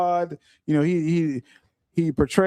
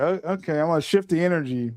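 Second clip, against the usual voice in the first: ordinary talk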